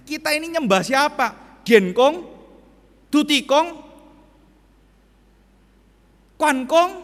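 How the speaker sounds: high, loud pressing questions; questioning friend. Retold or imitated. Asking temple friend, searching. (bertanya mendesak, keras dan tinggi)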